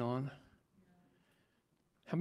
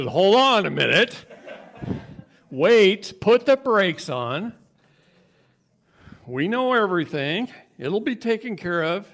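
loud, high retold plea to wait; urgent reining-in; 9 semitones higher, 12 dB louder than usual. Colleague telling him to put the brakes on, urgent. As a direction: imitate someone loudly calling for a halt.

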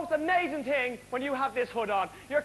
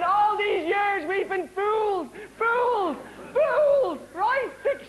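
high, hammed-up indignation; mock outrage; 6 semitones higher than usual. Comic to audience about fake rubber ducks, mock outraged. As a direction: protest in mock outrage, pitch climbing on each repeat.

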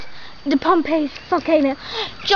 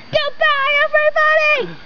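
excited goodbye; 11 semitones higher, 4 dB louder than usual. Real, not acted. Loud sign-off to audience.

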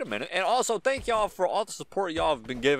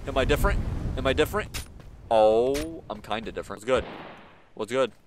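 usual speaking voice

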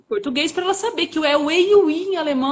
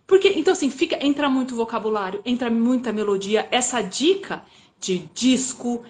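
ordinary talk, nothing marked

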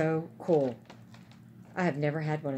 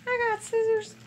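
high, louder sing-song I got scissors; sing-song announcement; 6 dB louder than usual. Real, not acted. Showing viewers her scissors, in a high, louder playful sing-song. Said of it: "hold that thought".

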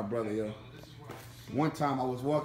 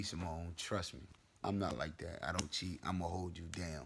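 hushed; 8 semitones lower, 12 dB quieter than usual. Real, not acted. Talking low, others asleep.

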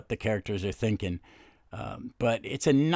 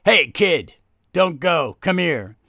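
put-on loud gruff call after the kid; 4 semitones higher, 8 dB louder, slower than usual. Bobo calls the kid back, loud and firm.

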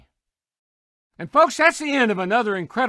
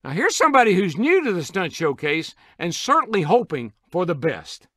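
ordinary talk, nothing marked

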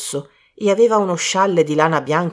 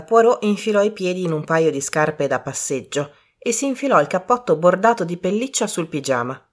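ordinary talk

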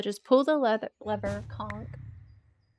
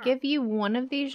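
ordinary talk, nothing marked

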